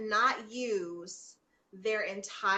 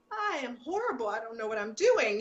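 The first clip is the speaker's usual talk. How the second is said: retold despair; 3 semitones higher than usual. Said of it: "I just was like".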